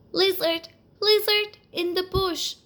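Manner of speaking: a breathless mouse summoning the lizard at full moon; called quickly. Acted. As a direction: call out fast into the bush. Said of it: "he called quickly".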